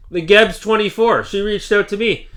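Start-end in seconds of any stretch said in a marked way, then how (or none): none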